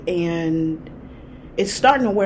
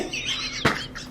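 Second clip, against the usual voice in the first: breathless laugh; 8 dB quieter than usual. Real, not acted. Laughing at own story.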